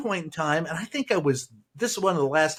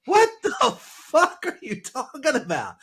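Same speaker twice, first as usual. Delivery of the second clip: high, stunned what-the-hell disbelief; incredulous outburst; 10 semitones higher, slower than usual. Retold or imitated. Reacting to Trump's magnet claim, high incredulous disbelief. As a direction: squeal in disbelief at a crazy claim.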